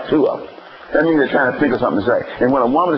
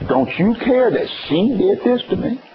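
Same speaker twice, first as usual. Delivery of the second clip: mock complaining Martha; 8 semitones higher than usual. Voicing Martha's complaint again.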